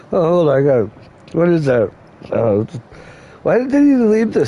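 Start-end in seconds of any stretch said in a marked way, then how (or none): none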